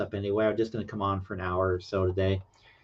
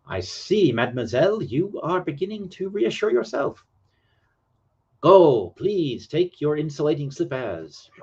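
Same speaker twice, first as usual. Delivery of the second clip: higher, swooping mock French accent; bad French accent; 6 semitones higher, 3 dB louder than usual. George Laurie reassures mademoiselle, in a hammed-up French accent. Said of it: "to read with a French accent".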